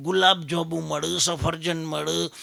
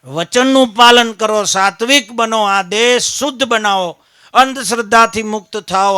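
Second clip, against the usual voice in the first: preaching at full force; 3 semitones higher, 9 dB louder than usual. Acted. Closing exhortation.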